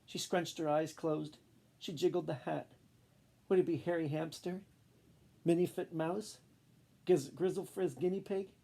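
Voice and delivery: ordinary delivery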